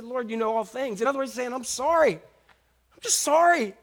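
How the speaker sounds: pleading, high-pitched remorse; anguished apology, stretched out and rising; hurt and pain. Acted. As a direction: apologise as if the pain is flooding back. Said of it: "all that hurt and pain came back".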